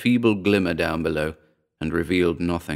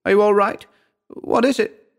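high, louder voice for Dora; anxious calling; 13 semitones higher, 4 dB louder than usual. A narrator's reading. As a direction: call down in a high voice, checking on him. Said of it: "she called".

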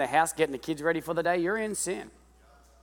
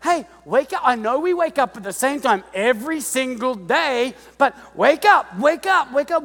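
high, insistent wake-up nagging; lightly voiced parent calling wake up; waking a son; 10 semitones higher, 8 dB louder than usual. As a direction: nag a kid out of bed. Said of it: "You know, like, at home".

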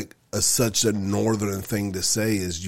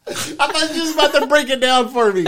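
high, loud, through real laughter; laughing; 8 dB louder than usual. Real, not acted. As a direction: crack up while teasing your co-host. Cracking up at shared ignorance.

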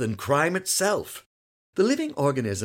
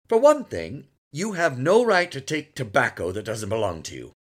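louder earnest lecture; moralizing father; 4 semitones higher, 6 dB louder than usual. A narrator's reading. Father lectures son on property, earnest. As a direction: explain right and wrong firmly.

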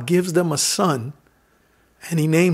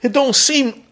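impassioned preaching; 5 semitones higher than usual. Acted.

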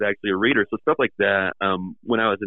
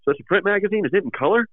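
puzzled guest; 11 semitones higher than usual. Retold or imitated. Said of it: "He goes".

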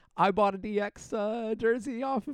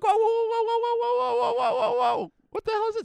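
much higher, rapid alarmed whoas; 13 semitones higher than usual. Retold or imitated. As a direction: back off startled and high. Retells alarm at liquor store.

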